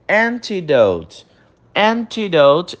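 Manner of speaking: antidote said slowly in English; slow model pronunciation. Shown as a demonstration. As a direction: stretch antidote out for repetition. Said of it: "você vai repetir a pronúncia". (antidote bem devagar em inglês)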